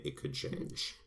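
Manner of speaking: ordinary delivery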